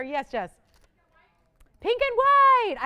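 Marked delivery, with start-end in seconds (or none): 1.8-2.8 high, loud drawn-out announcement; big ringing call. Real, not acted. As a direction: holler the item name out to the room. Host calls out the item.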